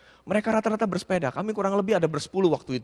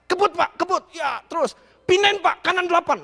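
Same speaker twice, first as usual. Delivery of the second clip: higher, louder barked orders; urging shout; 8 semitones higher, 5 dB louder than usual. Retold or imitated. Preacher mimics the host yelling gear changes on the climb. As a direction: shout him on like a drill coach.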